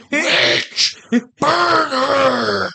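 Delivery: high mock mob shout, laughing. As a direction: yell like a witch-hunting villager. Mock witch hunt outcry.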